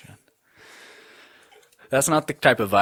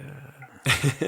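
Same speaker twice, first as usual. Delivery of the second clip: bored grunt; 5 semitones higher, 3 dB quieter than usual. Retold or imitated. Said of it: "I'm just kinda just like".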